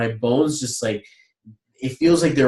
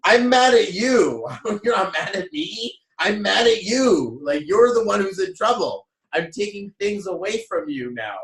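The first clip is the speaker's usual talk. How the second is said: high, exasperated mock scolding; stretched, indignant "mad at you"; retold mad parent; 8 semitones higher than usual. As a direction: act out a parent outraged right back. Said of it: "And I'm like".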